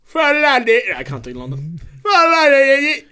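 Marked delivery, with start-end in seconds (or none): 0.0-0.8 high, loud cockney-style London voice; exaggerated London geezer; London accent. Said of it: "Do a London voice for me". 2.0-3.0 shrill hammed-up London geezer; high, loud mock cockney; London accent. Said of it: "Do a London voice for me".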